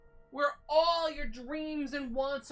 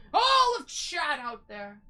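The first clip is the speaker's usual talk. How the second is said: grand call to chat; 8 dB louder than usual. Pretend. Calling out to the audience.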